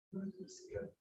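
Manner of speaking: whispered repeat of the line; softly whispered, almost no voice. Shown as a demonstration. Preacher whispers the line back to the congregation.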